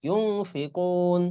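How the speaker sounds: ইউনফিকুন very slow, ন hidden nasal before ফি; slow ikhfa demo. Shown as a demonstration. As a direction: draw out ইউন with a nasal hum. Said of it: "এরকম উচ্চারণ হবে". (ধীরে গুন্নাসহ ইউনফিকুন)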